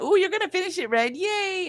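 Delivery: high, bright cheer for a friend; excited cheer. Real, not acted. Cheering on Ren near the finish, high excited voice.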